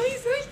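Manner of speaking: showing how she screams at horror movies, a high mock shriek. Shown as a demonstration. Describing horror movie fun, a playful high shriek. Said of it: "el que estás gritando".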